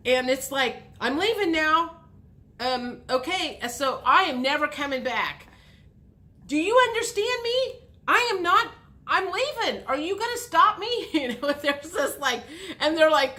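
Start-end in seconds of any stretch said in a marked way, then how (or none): 1.0-11.1 high, loud hammed-up diva walkout; milk the threat to leave, voice way up; dramatic exit. Gemini threatening to leave, high hammed-up drama.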